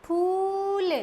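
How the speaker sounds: फूल with a long drawn oo; long oo sound. Shown as a demonstration. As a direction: stretch the oo in फूल. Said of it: "क्या शब्द बन गया".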